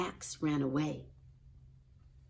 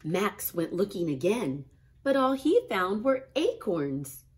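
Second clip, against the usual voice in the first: usual speaking voice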